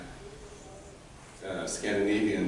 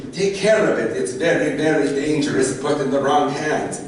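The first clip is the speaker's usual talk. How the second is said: loud, high-pitched granny warning; hammed-up urgent old woman; warning old Goda; 6 semitones higher, 9 dB louder than usual. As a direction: warn the fools with big drama. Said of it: "and she goes".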